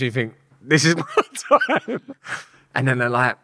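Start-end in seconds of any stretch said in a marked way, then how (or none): 0.6-2.1 a mock-doomed line broken by a laugh; laughing through it. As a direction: laugh as you admit the danger. Retelling a thought facing a gang.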